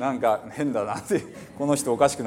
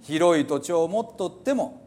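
put-on reading scripture in old Hiroshima dialect, preacherly and raised; 5 semitones higher than usual. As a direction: declaim the psalm like an old Hiroshima elder. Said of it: "ちょっと古い広島弁ですね". (古い広島弁で朗読)

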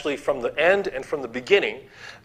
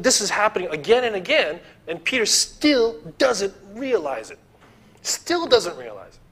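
exasperated; 7 semitones higher than usual. Real, not acted. Preacher exasperated at Peter.

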